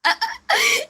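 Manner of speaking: shrieking giggle; high squealing laugh. Real, not acted. Laughing cry. (높은 웃음소리)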